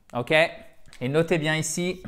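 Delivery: ordinary talk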